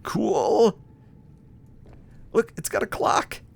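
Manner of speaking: very high, excited little squeak; delighted squeal. Real, not acted. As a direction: squeal with delight at a new find. Delighted by discovery while building in game.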